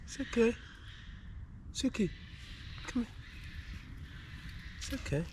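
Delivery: soft, high coaxing to the dog; gently calm a nervous dog; soothing. Real, not acted. Calming the scared dog.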